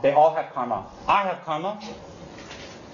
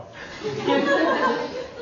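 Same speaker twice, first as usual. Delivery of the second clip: high burst of laughter. Real, not acted. Laughs at own imitation.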